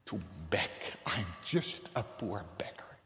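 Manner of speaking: low, hushed plea; soft, lowly supplication; humble begging. Acted. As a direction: sink to a near-whisper like a beggar. Acts the begging psalmist.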